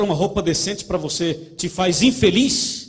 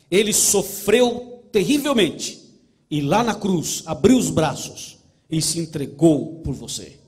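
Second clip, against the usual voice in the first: slow, louder fervent sermon build; preaching at full force; 6 dB louder, slower than usual. Acted. Pastor preaching the cross to the congregation, slow and louder. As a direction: drive it home slowly, swelling.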